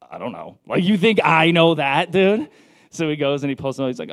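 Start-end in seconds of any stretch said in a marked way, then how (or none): 0.0-0.6 low, soft, clueless shrug; mild clueless mumble to the neighbor; sheepish mumble. Retold or imitated. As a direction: mutter it like you have no idea. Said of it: "And I was like".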